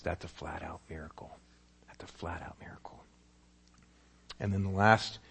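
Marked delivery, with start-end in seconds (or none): 0.0-1.3 hushed whisper of awe. Shown as a demonstration. Preacher whispering the miracle to the congregation. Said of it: "repeated whispered". 1.9-3.0 even softer whisper of awe. Shown as a demonstration. Preacher whispering again to the congregation. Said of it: "repeated whispered".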